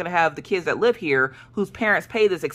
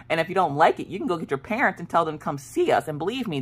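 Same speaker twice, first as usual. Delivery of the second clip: ordinary delivery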